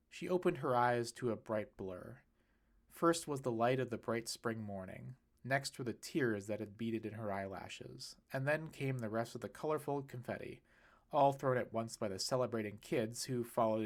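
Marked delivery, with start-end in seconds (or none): none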